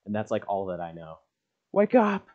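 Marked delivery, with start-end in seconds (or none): 1.7-2.3 loud high call to wake someone; wake-up shout. Acted. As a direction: shout him awake. Reading game dialogue aloud, shouting.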